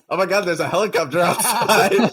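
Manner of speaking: high, loud improv exclamation; hammed-up announcement breaking into laughter; mock alarm. Retold improv character announcing a helicopter.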